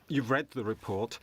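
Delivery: ordinary talk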